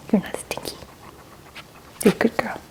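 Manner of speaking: ordinary talk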